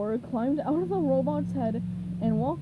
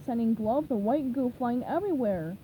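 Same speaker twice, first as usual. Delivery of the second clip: ordinary delivery, nothing marked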